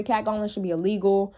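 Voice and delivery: usual speaking voice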